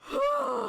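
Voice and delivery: high, loud recovering whoo; breathless whoop. Real, not acted. Streamer about to pass out from laughing, breathless whoop. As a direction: let out a high whoo after laughing too hard. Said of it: "I'm gonna pass out".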